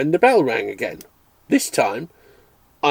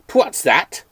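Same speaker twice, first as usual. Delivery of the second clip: put-on Irish accent; 7 semitones higher, 5 dB louder than usual. A narrator's reading. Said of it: "rich, unexpected accent".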